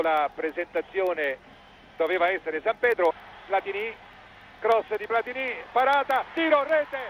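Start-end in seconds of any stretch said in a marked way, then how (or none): 4.6-7.0 rising, higher goal call; breathless italian goal commentary. Real, not acted. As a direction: shout the goal as it happens. Live goal call on radio. (telecronaca concitata del gol)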